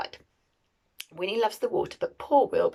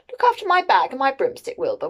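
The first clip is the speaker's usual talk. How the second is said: higher, louder witch calling out; Winnie calling instructions; 5 semitones higher, 8 dB louder, faster than usual. A narrator's reading. Winnie leaving Wilbur to guard her bag, calling out. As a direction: call out brightly to the cat. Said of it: "Winnie said".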